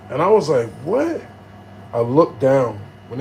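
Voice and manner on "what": high rising startled question; retold disbelief. Man told he's hurt, high incredulous reaction. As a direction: act out being caught off guard.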